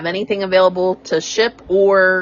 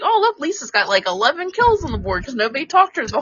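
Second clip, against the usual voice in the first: higher, fast excited voice; mock teammate; 6 semitones higher, faster than usual. Retelling teammate's praise, higher and quick. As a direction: rattle off gleefully. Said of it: "Like, like".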